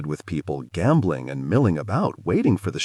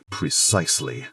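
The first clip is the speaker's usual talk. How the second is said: growl; 6 semitones lower than usual. A narrator's reading. Kai answering River, low growl. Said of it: "he growls".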